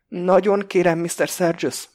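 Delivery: put-on high, loud, childish plea from a frightened hostage; trembling, childlike. A narrator's reading. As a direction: plead helplessly like a scared child. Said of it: "hangja remegő és gyerekes". (remegő, gyerekesen kérlelő hangon)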